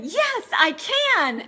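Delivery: high, slow, emphatic yes; eager yes. Retold or imitated. Agreeing to the TV offer, slow excited yes. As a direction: commit with big slow enthusiasm. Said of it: "so I said".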